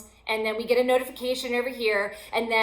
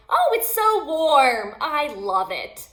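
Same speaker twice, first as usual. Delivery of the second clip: slow, loud, sing-song "Oh, it's so warm"; mock cheerfulness; 4 semitones higher, 6 dB louder, slower than usual. Joking about a burning house, mock-cheerful and slow. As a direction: ham up delight while the house burns. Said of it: "don't be like".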